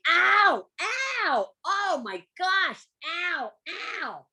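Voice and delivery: high pained yelps of ow; hammed-up pain yelling; acted yelling in pain. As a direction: yelp like something is hurting your ear. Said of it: "I start yelling".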